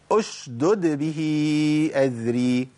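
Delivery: slow recited oshdud bih with both dals clear; Quranic recitation. Shown as a demonstration. Sheikh reciting the verse slowly to students. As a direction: recite أشدد slowly, both dal sounds separated. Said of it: "هنجيب قوله تعالى".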